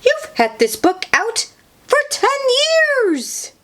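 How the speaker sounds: high, rising outraged cry; shocked shout. A narrator's reading. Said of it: "she shouts".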